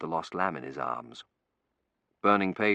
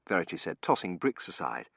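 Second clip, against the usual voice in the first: ordinary delivery, nothing marked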